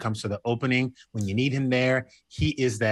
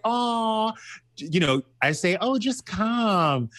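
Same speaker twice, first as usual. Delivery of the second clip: coaxing mock voice; 9 semitones higher, much slower than usual. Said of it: "I was like".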